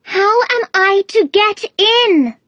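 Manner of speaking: put-on very high girlish voice, slow and emphatic. A narrator's reading. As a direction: demand it firmly, high and slow, falling at the end. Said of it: "in a louder tone".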